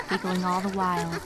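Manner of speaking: ordinary delivery, nothing marked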